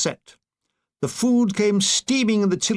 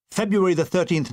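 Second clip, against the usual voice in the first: usual speaking voice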